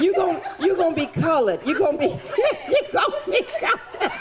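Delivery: laughing through the words, pitch climbing high. Real, not acted. Teasing a child volunteer on stage, laughing. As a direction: giggle it out as you tease.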